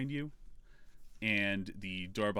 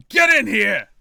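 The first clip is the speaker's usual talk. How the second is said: very loud, high-pitched yell; shouted order; 12 semitones higher, 18 dB louder than usual. Acted. Game master plays the rattled gunman calling out, shouting. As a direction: bellow for backup in alarm. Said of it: "and he shouts".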